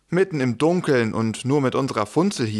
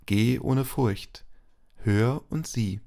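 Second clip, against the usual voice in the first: solemn blessing; 3 semitones lower, 3 dB quieter than usual. Acted. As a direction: bless them gently and solemnly. Pastor speaks closing blessing.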